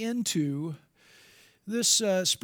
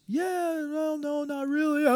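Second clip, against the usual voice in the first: a higher, lightly voiced student backpedalling; hesitant student; 7 semitones higher, slower than usual. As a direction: waver from yes to no in a higher teen voice.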